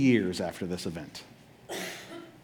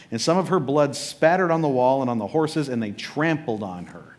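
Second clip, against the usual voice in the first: ordinary talk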